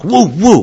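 two loud, high woofs; barking along. Shown as a demonstration. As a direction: bark along with the dog. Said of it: "We're gonna bark along with the dog".

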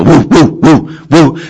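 high, loud imitated barking woofs; imitated dog bark. Dog barking at its owner to open the door, high woofs. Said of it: "The dog barks for the owner".

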